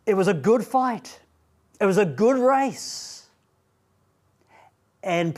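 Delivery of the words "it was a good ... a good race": "good" lifted and punched in each line; stress on good. Shown as a demonstration. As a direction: hit "good" hard, let "fight" and "race" fall. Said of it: "The emphasis is".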